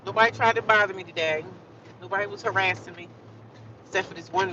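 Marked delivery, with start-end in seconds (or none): none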